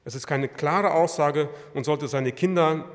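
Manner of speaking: normal talking voice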